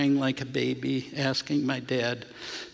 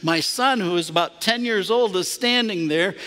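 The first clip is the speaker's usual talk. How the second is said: higher, louder, fervent preacher's telling; preaching at full force; 5 semitones higher, 4 dB louder than usual. Acted. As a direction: pour joy into the story as a sermon.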